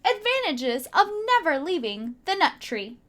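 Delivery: ordinary delivery, nothing marked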